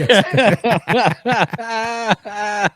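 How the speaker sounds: long, high laugh; real laughter. Real, not acted. Reacting to the comedian's joke, laughing. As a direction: laugh hard and let it trail on.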